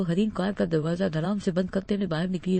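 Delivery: ordinary delivery, nothing marked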